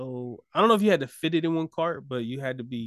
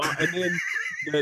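usual speaking voice

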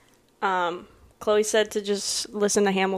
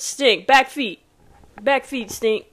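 ordinary talk

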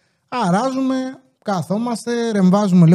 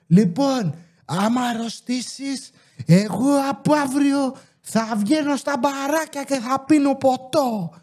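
mock scolding mother; 6 semitones higher, slower than usual. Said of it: "Και αρχίζει και φωνάζει και λέει".

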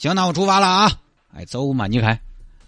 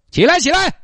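much higher, sharp command to get up; barked order; 13 semitones higher, 3 dB louder than usual. Acted. Traffic cop barking at the sleeping drunks to get up. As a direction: wake them with a barked order.

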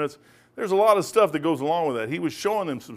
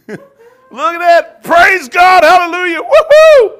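laughing, then much higher and louder praise shouts; joyful shout; 14 dB louder, much slower than usual. Real, not acted. Preacher cheering a latecomer's arrival, shouting praise.

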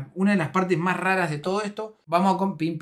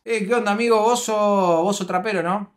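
loud, higher-pitched chummy stranger; imitated pushy fan; 4 semitones higher, 6 dB louder than usual. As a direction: butt in loudly on someone's meal. Acting out intrusive fan approaching. (imitando fuerte a un fan metido)

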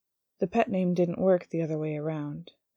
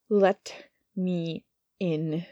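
slow, every word bitten off hard; each word bitten out; 4 dB louder, much slower than usual. Acted. Angel at the demon's intercom, each word bitten off slowly. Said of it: "bit each word out".

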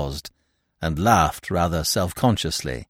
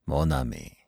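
low, soft, gentle address; a touch of gentle irony, dropped low; gently ironic; 8 semitones lower, 7 dB quieter than usual. Acted. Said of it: "in his gentle, ironical voice".

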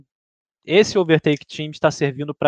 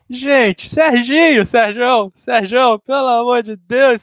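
laughing disbelief; 8 semitones higher, 3 dB louder, slower than usual. Real, not acted.

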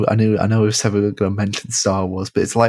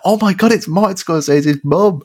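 starstruck excitement; 7 semitones higher than usual. Retold or imitated. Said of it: "I was like".

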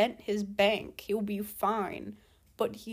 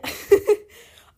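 sudden loud laugh; 11 semitones higher, 11 dB louder than usual. Real, not acted. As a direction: burst out laughing, high and breathy. Amused at own rant.

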